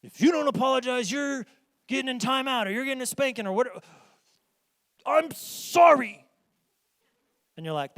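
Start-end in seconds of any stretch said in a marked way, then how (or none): 0.0-3.8 threatening parent. Retold or imitated. Parent threatening a refusing child. 5.0-6.3 high, loud, slow grudging sorry spat out; angry forced apology. Retold or imitated. Kid forced to apologize to sister, loud angry sorry. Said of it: "'Cause you're angry when you say it".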